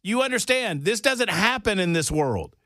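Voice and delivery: high-pitched, insistent raving; emphatic amazement. Real, not acted. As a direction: press the astonishing point home in a high voice. Amazed rant about a player's feat.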